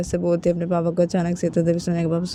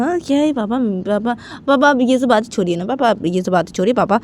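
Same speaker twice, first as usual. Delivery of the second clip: stammering, hurried denials and excuses; flustered daughter; 7 semitones higher, 5 dB louder than usual. Acted. Daughter taking back what slipped out about Simran, flustered. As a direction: backtrack nervously, tripping over restarts. Daughter backtracks after blurting confession.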